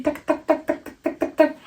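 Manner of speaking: soft, quick tac tac of steps; imitated footsteps. Narrator mimicking their hurried footsteps, soft clicking sound. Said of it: "footsteps imitated".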